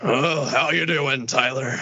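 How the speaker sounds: put-on low, slow old man voice; creepy old coworker. Old man greeting a coworker, creepy old coworker voice. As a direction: imitate a hunched old man, unhurried. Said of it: "there's this old guy who works there".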